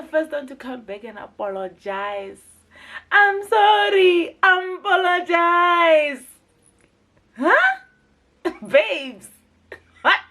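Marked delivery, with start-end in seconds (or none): 3.1-6.2 high, slow, drawn-out sarcastic sorry; mock apology. Mocking the girl's apology, high and slow sarcastic. 7.4-10.2 high, loud disbelieving huh; incredulous huh. Real, not acted. Reviewer reacting to the apology, high incredulous huh.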